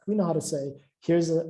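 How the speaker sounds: ordinary talk, nothing marked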